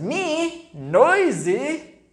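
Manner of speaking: high, loud offended disbelief; indignant monkey. A narrator's reading. Monkey offended at being called noisy, high and loud. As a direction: squeak out the outrage. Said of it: "said the monkey".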